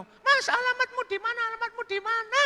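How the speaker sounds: squeaky-high falsetto questioning; woman's voice. Acted. Woman asking the visitor's name, high falsetto. Woman asks departing Burhan's address.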